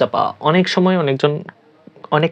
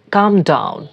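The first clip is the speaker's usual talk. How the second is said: normal talking voice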